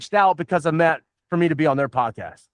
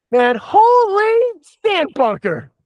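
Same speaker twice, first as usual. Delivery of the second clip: drawn out, much higher, slow and showy: holy sand bunker; mock exclamation; 13 semitones higher, 3 dB louder, much slower than usual. Guest recreating his on-air outburst for the hosts, hammed-up and slow. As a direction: belt it like a golf course catchphrase.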